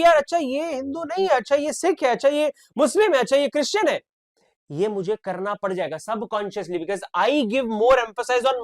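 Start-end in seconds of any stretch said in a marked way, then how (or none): 0.0-4.0 higher, mock surprised noticing of each label. Teacher acting out noticing every new student's religion, mock surprised. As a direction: act out spotting each child's religion.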